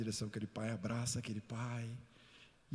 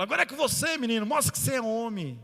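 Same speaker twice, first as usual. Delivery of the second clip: father's shout; 10 semitones higher, 9 dB louder than usual. Retold or imitated. Father challenging his son.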